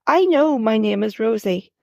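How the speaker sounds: usual speaking voice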